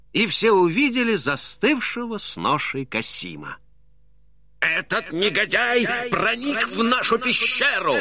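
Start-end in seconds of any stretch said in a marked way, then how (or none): 4.6-8.0 high, outraged bandit chief; indignant roar. A narrator's reading. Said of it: "в негодовании взревел главарь".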